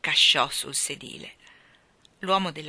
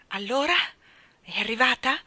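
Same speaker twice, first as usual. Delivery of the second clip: tentative, anxious question with raised pitch; anxious whisper; 5 semitones higher, 4 dB louder than usual. A narrator's reading. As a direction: ask nervously, voice lifting at each question. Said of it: "domandò con ansia in un bisbiglio". (domanda ansiosa, intonazione sospesa)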